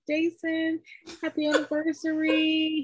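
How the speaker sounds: high, singsong greeting; loopy self. Retold or imitated. Calling her ex-husband while high, singsong. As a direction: lilt it out, dazed on meds. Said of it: "I'm saying".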